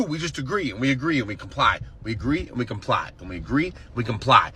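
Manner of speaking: low, quiet, weary repetition; resigned murmur. Real, not acted. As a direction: mumble it like worn-down compliance.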